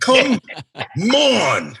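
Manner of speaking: loud, high, split into two separate cries; drawn-out exasperated outburst. Retold or imitated. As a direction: break COME and ON apart with a big pause. Same exclamation, broken in two.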